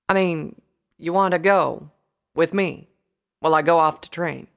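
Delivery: loud, surprised pony voice; Applejack, incredulous. A narrator's reading. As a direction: ask it in halting disbelief.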